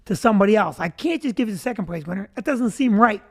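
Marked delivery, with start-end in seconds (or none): none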